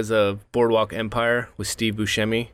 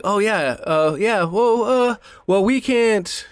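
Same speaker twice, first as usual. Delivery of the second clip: high, halting stalling agent voice; mocking a fumbling insurance rep, high and slow; mock flustered agent; 10 semitones higher, slower than usual. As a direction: drag out the umms like a clueless rep.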